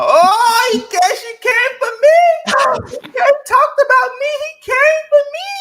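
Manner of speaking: shrieking high mock-wail, far above her own voice; Spice playing victim. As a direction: whine like she's the one wronged, loud and crying. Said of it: "put on that victim thing".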